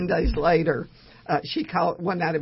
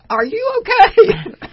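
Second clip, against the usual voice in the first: laughing question; 13 dB louder, slower than usual. Retold or imitated. Friend reacting to giddy news.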